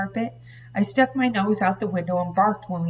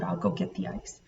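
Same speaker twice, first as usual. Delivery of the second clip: whisper; 12 semitones lower, 7 dB quieter than usual. A narrator's reading. Leaving tense room.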